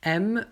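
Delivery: M as "èm", open è; grave or circumflex. Shown as a demonstration. Teacher reads example aime, open è in M. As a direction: say M with an open "eh" vowel. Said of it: "avec un accent grave ou circonflexe".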